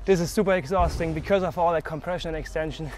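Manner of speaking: panting after skating hard; winded, gasping between words; out of breath. Real, not acted. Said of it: "I'm super winded".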